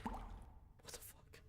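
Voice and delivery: slow breathless whisper; barely breathed, afraid to be heard. Real, not acted. Scared player hiding in the dark, whispering.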